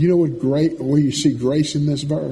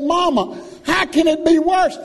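put-on frantic young son. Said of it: "Marcel said".

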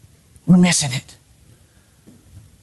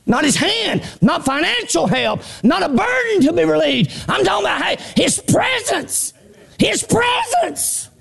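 preaching at full force; 12 semitones higher than usual. Acted. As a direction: build to a preacher's shout. Preacher builds to a shout.

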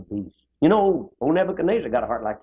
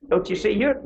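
normal talking voice

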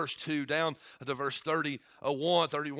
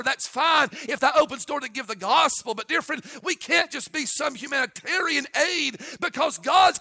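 rising from raised voice to high, loud shouting; building preaching; 12 semitones higher, 11 dB louder than usual. Acted. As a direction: climb to full revival cry.

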